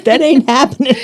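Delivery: a laughing student's cheeky refusal; laughing mock protest. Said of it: "raised our hands and said".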